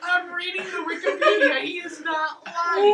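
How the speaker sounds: loud, laughing through the words. Real, not acted. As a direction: laugh as you confirm it. Laughing while confirming plot.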